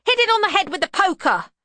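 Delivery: put-on high, loud boy's yell; shrill-pitched childish urging; excited shout. A narrator's reading. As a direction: egg her on loudly, like an eager child. Excited boy eggs her on.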